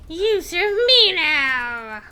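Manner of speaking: slow, loud, high undead command; imitating lich voice. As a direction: ham up the lich's order. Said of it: "the voices I did today ... pretty sad".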